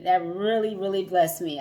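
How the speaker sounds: ordinary talk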